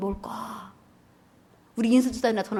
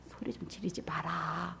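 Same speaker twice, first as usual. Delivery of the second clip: whispered complaint; 5 semitones lower, 13 dB quieter, slower than usual. Acted. Annoyed neighbor at group prayer.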